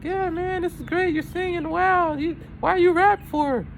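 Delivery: very high put-on falsetto; high-pitched mock amazement; enthusiastic commenter. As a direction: imitate a fan gushing in a squeaky-high voice.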